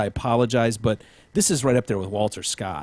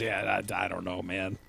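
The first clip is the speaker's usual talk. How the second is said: much softer, lower mumble; imitating the cop; 5 semitones lower, 11 dB quieter than usual. Host voicing the cop's answer, soft low mumble. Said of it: "his answer was".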